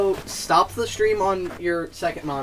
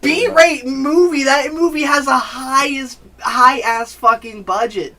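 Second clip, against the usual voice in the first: slow, high disbelieving challenge; incredulous; 8 semitones higher, slower than usual. Real, not acted. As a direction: stretch it out in disbelief. Incredulous pushback in argument.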